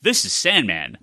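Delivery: usual speaking voice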